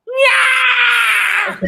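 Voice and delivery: long, very high scream; hammed-up drawn-out squeal; celebratory scream. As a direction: shriek in wild celebration. Reenacts reaction to winner's announcement.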